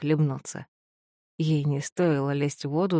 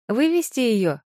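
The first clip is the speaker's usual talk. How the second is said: shouted order, higher and louder; 6 semitones higher, 4 dB louder than usual. A narrator's reading. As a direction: call it out sharply. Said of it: "кричит Юнис".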